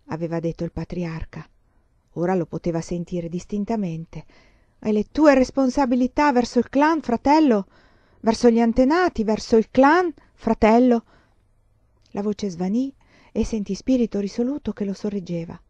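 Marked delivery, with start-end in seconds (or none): 4.8-11.0 raised, insistent, reproachful questioning; stern reproach. A narrator's reading. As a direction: press the duty home, pitched up and loud. Voce ricordata del patriarca che rimprovera. (rimprovero severo e incalzante)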